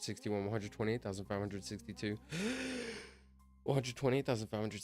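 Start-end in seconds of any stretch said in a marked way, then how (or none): 2.2-3.0 long, high gasp; high-pitched gulp of air. Real, not acted. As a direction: suck in a big breath mid-count. Gasps while counting for long.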